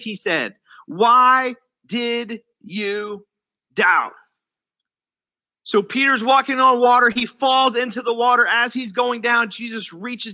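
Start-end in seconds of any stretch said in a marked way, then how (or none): 0.9-4.1 very slow, one word at a time; slow, pointed question. Retold or imitated. Jesus questioning sinking Peter, slow and pointed. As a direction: draw out each word, dropping on the last.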